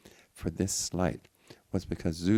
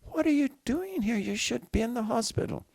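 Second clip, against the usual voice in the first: alarmed; 5 dB louder than usual. Acted. Mother shocked at son's arrival.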